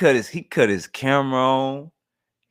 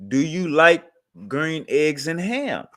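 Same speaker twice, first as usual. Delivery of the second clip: slow storybook reading of the question; slow read-aloud; 2 semitones higher, slower than usual. Retold or imitated. Host reads Green Eggs and Ham to chat, slowly. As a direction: read it slowly like a kids' book. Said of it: "it says".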